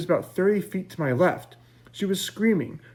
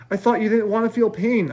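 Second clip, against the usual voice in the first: higher, louder protest; a heated objection; 8 semitones higher, 5 dB louder than usual. A narrator's reading. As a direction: push back, hurt and defensive. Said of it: "I protested".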